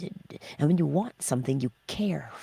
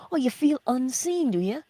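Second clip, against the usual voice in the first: high, louder teasing "Oh"; mock sympathy; 7 semitones higher, 4 dB louder than usual. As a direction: tease the excuse, raising the "Oh" high. Mock challenge of listener's excuse.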